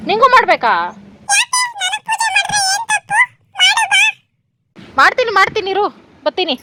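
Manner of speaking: high, loud pet talk; calling to the pet. Real, not acted. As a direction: call out playfully to the animal. Talking to her pet.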